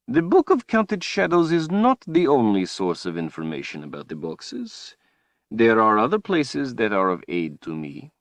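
a taunting sorcerer pressing his point. Acted. Villain Rahl speaks.

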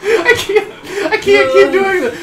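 high-pitched, helpless protest; frightened and laughing. Real, not acted. Player too scared to keep playing, frightened protest. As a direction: give up in laughing panic. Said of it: "I'm so fucking frightened right now".

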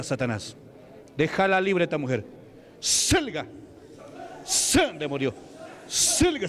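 exorcism command. Real, not acted. Preacher ordering witchcraft out of a woman, insistent exorcism. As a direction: drive out the demon, punching each command.

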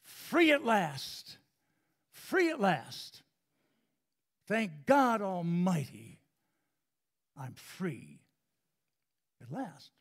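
hint of Martin Luther King's cadence; echoing King. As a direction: ring it out like a preacher at the Memorial. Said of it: "He said these words".

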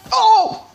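very high, loud shriek; piercing high cry of fright; startled scream. Real, not acted. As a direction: scream at a sudden scare. Jump scare in horror game.

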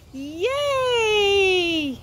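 long high yay; drawn-out cheer. Real, not acted. Presenter celebrating with young viewers, drawn-out cheer.

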